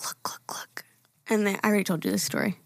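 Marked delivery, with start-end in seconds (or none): 0.1-0.8 hushed whisper; whispered look. Retold or imitated. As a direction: whisper it urgently to point something out. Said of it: "Zane was like".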